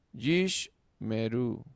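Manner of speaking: "Yish meru" drawn out slowly, with "yish" leaned on; sheva read slowly. Shown as a demonstration. Teacher showing the class a silent sheva, slowly. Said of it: "Está en silencio".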